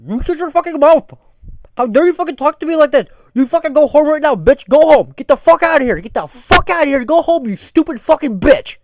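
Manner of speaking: full-force high, loud tirade; furious boss shouting. Retold or imitated. Boss exploding at worker out of nowhere, furious shouting. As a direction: scream them out of the building. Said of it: "I can't do his".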